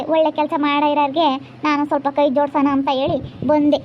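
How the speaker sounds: usual speaking voice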